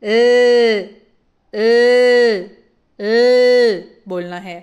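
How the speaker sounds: long loud "ए" with tongue behind the teeth; held l sound. Shown as a demonstration. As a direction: hold "ए" out loudly, tongue up. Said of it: "जीभ को ऊपर वाले दांतों के पीछे लगाकर".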